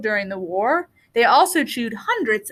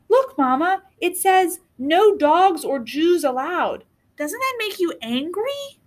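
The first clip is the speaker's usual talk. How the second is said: put-on upset child; 6 semitones higher, slower than usual. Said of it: "Ruth saw a disturbing sign".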